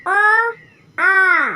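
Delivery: "अ" short and "आ" long, both called out loud; loud clear demo, "अ" short then "आ" stretched long; short and long vowel. Shown as a demonstration. Teacher calling vowels out to the class, loud and long. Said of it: "अ को हम लोग simple तरीके से बोले".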